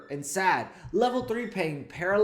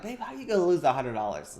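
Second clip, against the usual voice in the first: mildly exasperated, quick mock complaint; quick, lightly frustrated husband to his wife; a little frustrated; 3 semitones higher, faster than usual. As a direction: act out slight frustration, speaking fast. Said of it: "I'm a little frustrated".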